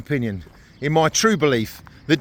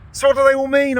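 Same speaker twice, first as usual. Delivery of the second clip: voicing a curious viewer, higher, louder; imagined viewer asking; 10 semitones higher, 5 dB louder than usual. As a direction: ask it like a puzzled viewer. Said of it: "I hear you ask?".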